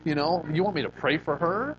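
high, whiny mock complaint; a hammed-up grumble of refusal; reluctant inner protest. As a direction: argue back at the Spirit, put out. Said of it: "And I'm like".

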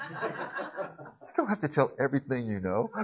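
soft, slow, laughing aside; laughing tease. Real, not acted. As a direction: tease them through a laugh. Teasing aside amid class laughter.

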